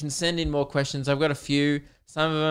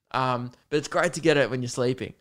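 ordinary delivery

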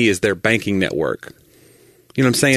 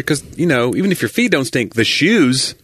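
normal talking voice

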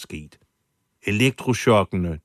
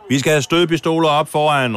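loud, high call to the crowd; shouted command; 8 semitones higher, 7 dB louder than usual. Retold or imitated. Rioter shouting to the crowd for stun guns, loud. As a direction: shout stødpistoler over the mob. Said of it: "råbte Rodriguez ind i mængden".

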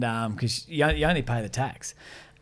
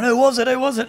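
higher, louder angry crowd shouting; mock shout; 10 semitones higher, 7 dB louder, faster than usual. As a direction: bark it like a mob.

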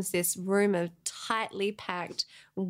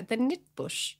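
put-on New Zealand accent, NUT said like "nit"; mock New Zealand accent; 4 semitones higher, slower than usual. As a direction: imitate a New Zealander with squashed vowels. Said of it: "saying it in the wrong accent".

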